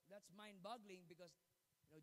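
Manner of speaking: usual speaking voice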